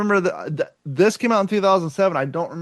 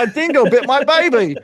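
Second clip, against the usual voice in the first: high, loud laughing Australian; hammed-up Aussie outcry; loud mock Australian, laughing; Australian accent; 7 semitones higher, 5 dB louder than usual. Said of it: "Australia or New Zealand".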